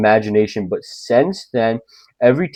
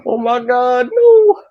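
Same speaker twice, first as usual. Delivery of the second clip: much higher, hammed-up shrieking panic that ends in a laugh; mock panic; 12 semitones higher than usual. Scared viewer yelling at the reflection in mock shrieking panic. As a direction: squeal in pretend terror. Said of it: "and you're like".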